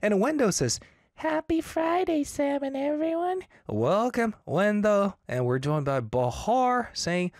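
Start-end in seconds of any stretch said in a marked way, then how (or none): 1.2-3.4 high cheery greeting. Retold or imitated. Reading a listener's greeting aloud.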